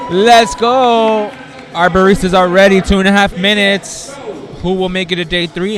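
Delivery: announcer hype. Acted. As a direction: fire up the room like a competition host. Host hypes the round start.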